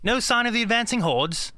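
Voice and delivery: lightly elongated 1940s British stage accent. Shown as a demonstration. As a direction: elongate the vowels in a minimal BBC English. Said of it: "I usually walk in like".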